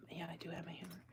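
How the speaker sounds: unvoiced whisper; whisper to self. Real, not acted. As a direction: murmur the choice privately. Whispering to herself.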